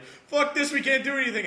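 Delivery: hammed-up outburst of defeat; exasperated heroes. Heroes despairing in retold comic fight.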